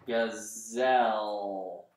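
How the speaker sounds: Gazelle drawn out very slowly; teacher sounding out a word. Shown as a demonstration. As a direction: stretch every sound of Gazelle. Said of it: "that sounds like a Z to me".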